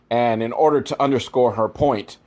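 ordinary talk, nothing marked

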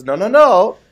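high, primly scolding no; prim praying lady. Retold or imitated. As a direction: play a fretting church lady. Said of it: "She goes".

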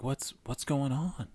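high, slow, gently puzzled boss; concerned Southern lady. Retold or imitated. Boss asks Dart why he needs time off, high, slow and concerned. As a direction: ask it like a kind Southern lady leaning in. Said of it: "and she's like".

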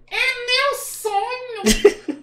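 very high, loud, hugely drawn-out puppet cry; Kleber. Acted. Puppet declaring his dream, high puppet voice. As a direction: squeal it slowly as a dreamy dummy. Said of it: "acha uma voz que pareça com o boneco".

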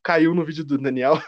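normal talking voice

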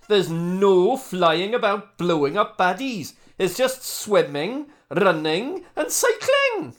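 loud, slow Welsh scolding; Welsh accent. Acted. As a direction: lecture them loudly in Welsh accent. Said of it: "I think Welsh really added to it".

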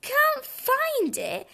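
high, drawn-out astonishment; incredulous robin, voice swooping up; exclaiming. A narrator's reading. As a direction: exclaim it slowly in disbelief. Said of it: "exclaimed Robin".

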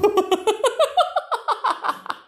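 high squealing laugh; burst of laughter. Real, not acted. Remembering the fake perfume prank, high squealing laughter. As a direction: crack up at the memory.

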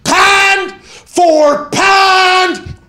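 booming, very drawn-out Pittsburgh "pand for pand"; Pittsburgh accent. Acted. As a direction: announce it high, loud and stretched with Pittsburgh vowels. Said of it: "That's a Pittsburgh accent".

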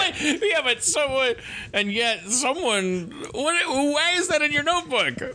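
high, laughing, incredulous questions; excited rambling. Real, not acted. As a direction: pitch way up while laughing at him. Laughing incredulous teasing, high pitch.

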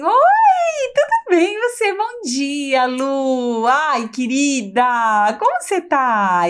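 high sugary greeting; cheery fake voice. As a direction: gush it, always smiling. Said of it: "Aquela pessoa assim".

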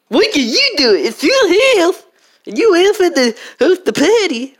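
put-on high whiny bratty child; bratty kid voice. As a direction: talk back like a spoiled kid.

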